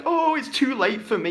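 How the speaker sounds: mocking whiny quote. Coach mocking a defeatist older guy, whiny. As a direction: mimic the defeatist sulking. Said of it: "being like".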